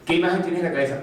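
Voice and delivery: ordinary delivery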